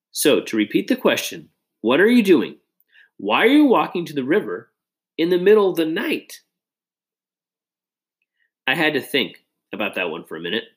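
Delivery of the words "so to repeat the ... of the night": lightly voiced teasing buddy, a bit higher; teasing friend Josh. A narrator's reading. Best friend ribbing Nate about a girl, lightly teasing voice. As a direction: needle him like a grinning best friend.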